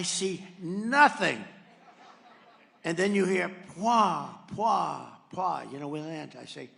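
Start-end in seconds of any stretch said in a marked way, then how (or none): 3.8-5.7 louder puffed bumps; landing thud noises. Retold or imitated. Telling the crowd how the plane landed, as puffed thud noises. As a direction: mimic the wheels hitting the runway.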